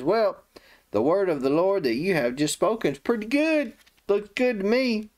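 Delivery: high, lightly mocked complacency; self-satisfied. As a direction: imitate a king pleased for himself. Acts Hezekiah selfishly pleased with prophecy.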